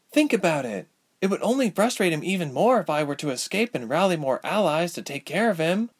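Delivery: a lightly voiced scheming sorceress; scheming villainess. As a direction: talk her into a deal. Amelia voiced higher.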